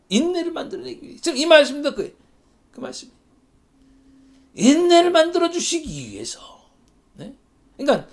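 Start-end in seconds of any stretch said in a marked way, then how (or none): none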